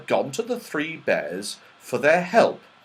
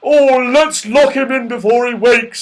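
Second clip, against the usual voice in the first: loud, raised bear voice; Father Bear, urgent; 11 semitones higher, 11 dB louder than usual. A narrator's reading. Father Bear wants the sleeper locked in, urgent. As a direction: urge them on as a big bear. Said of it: "said the Father Bear".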